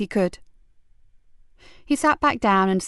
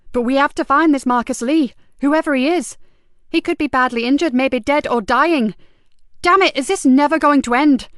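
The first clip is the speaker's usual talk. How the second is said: loud, higher exasperated outburst; frustrated; 5 semitones higher, 6 dB louder than usual. Acted. DI on the phone, exasperated and loud. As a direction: vent the frustration.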